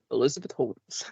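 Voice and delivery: a hint of her low forced voice; lightly voiced deep Holmes. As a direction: say the name in a mock low corporate tone.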